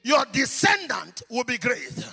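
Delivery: loud, high, chanting sermon climax; preaching at full force. Acted. Preacher calling on women and choir, preaching at full force. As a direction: rouse the church into a fervent blessing.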